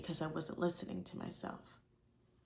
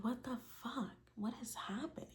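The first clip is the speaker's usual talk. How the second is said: higher, softer stunned disbelief; hushed shock; 5 semitones higher, 9 dB quieter than usual. Retold or imitated. Reacting to cutting her finger while doing dishes, hushed shocked disbelief. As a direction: gasp it out under your breath, bewildered.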